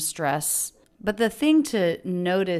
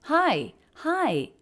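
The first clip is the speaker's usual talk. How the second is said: "Hi, hi." rising much higher, then falling; 10 semitones higher than usual. Shown as a demonstration. Teacher repeating the greeting to show its up-down tune. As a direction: sing "hi" up high and let it drop. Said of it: "up-down shape".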